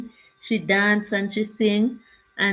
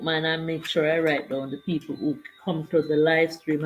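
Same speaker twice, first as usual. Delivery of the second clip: ordinary talk, nothing marked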